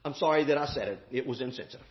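preacher mimicking a man who caved to critics; meek apology. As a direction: shrink back as you say sorry. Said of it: "backed up a couple steps and said".

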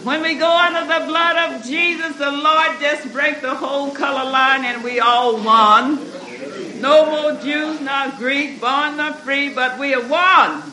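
loud, high preaching build; rising sermon fervor. Acted.

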